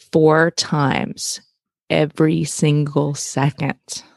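slow, emphatic repeat, word by word; super slow. Shown as a demonstration. Host repeating crazy information for listeners, super slow. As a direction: space out each word for impact. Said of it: "I'm gonna say it twice".

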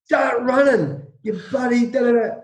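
a retold coach laying down the law; stern coach orders. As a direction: bark orders at the hungover players. Coach orders hungover players.

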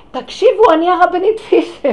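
retold dream shout. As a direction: demand the court's attention loudly. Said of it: "ואז אני צועקת".

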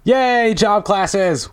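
high, bright cheer of real joy; excited cheer. Real, not acted. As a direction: cheer it out with delight. Genuine joy at job classes.

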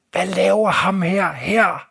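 slow, drawn-out call across the yard; a hint of a suspicious old lady shouting; old woman's mock shout. Old woman yells at the passing stranger, slow mock shout. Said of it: "så råber hende gamle dame".

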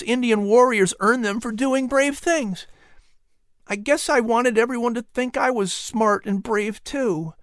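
put-on high boy's voice, confessing; a boy owning up to his pride; ashamed Patrick. A narrator's reading.

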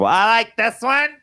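mimicking a child's show-and-tell; little daughter's presentation. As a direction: present it like a little kid, drawing out the picks. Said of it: "she's got a good presentation".

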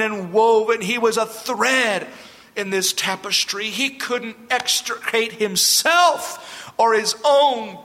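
high, urgent preaching voice; impassioned sermon delivery; fervent preaching. Acted. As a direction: preach it with rising fire. Preacher expounds with rising fervor.

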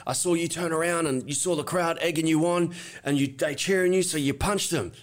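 pumped-up cop. Retold or imitated. Second cop comes over to arrest him, pumped up. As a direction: imitate a cop all fired up making an arrest. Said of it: "held pumped up".

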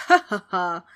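loud villainous cackle; horrible ghost laugh. A narrator's reading. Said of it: "The ghost laughed its horrible laugh".

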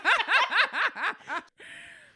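shrieking laugh; high loud laugh. Real, not acted. Big laugh.

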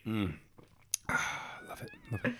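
satisfied sigh after a sip; savoring a sip of coffee; after sipping a drink. Real, not acted. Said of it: "drinking my coffee".

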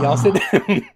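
put-on Southern mom telling the kids to sit down. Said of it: "now I can't get out of the voice".

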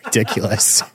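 laughing through the word; real laughing. Real, not acted. Podcaster cracking up at own joke about looks, laughing. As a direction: break up laughing as you say it.

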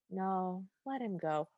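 soft wistful sigh of a line. Retold or imitated. King to the Grand Duke, softly wistful. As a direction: say it softly, letting go. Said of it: "wistful look on his face".

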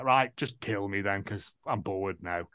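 sag into it like you can't be bothered; a deflated, fading sigh of a line; bored resignation. Retold or imitated. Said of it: "And he's like".

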